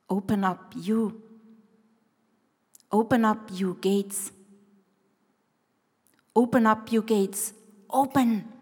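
slow, savoring each word; slow meditative repetition. Shown as a demonstration. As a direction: let each word melt, slowly. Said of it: "melting the Bible verse in your mouth".

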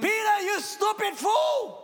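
slow mocking shout; taunting voices. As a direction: yell it slowly like a jeering crowd. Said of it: "The voices will be telling him".

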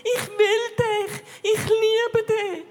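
voicing God as a loving father to her; loving father's words. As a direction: pour unconditional love into each line. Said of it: "wie er zu mir sagt".

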